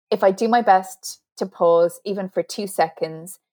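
ordinary delivery

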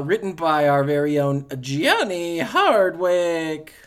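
loud, drawn-out mock announcer intro; badly faked ring announcer, stretching the name. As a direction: do a hammy announcer, big and slow. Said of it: "that's my really bad announcer voice".

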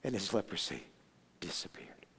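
very soft, low, whispery narration; awed hush. A narrator's reading. Preacher revealing the miracle, in a hushed voice. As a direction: let it fade to a whisper.

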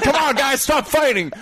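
high-pitched cartoonish plea; costumed peacemaker. Costumed bystander pleading with brawlers, high mock voice. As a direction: whine it high like a silly mascot.

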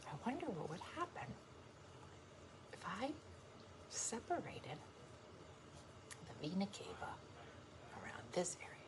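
very soft, near-whispered musing; hushed imagined voice. Acted. Woman voicing what the watcher is thinking, hushed musing. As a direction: murmur it like private scheming, slowing into pauses. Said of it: "She's like".

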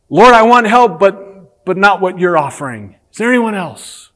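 hammed-up plea; high, loud mock prayer. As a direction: voice the reluctant believer. Voicing a reluctant believer.

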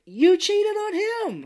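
high, loud outraged yell at the screen; exasperated yell. Retold or imitated. Yelling at the screen at a cheating wife, outraged. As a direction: shout it at the screen in disbelief. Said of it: "I'm like".

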